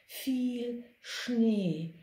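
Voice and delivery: polar bear voice. A narrator's reading. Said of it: "sagte der Eisbär".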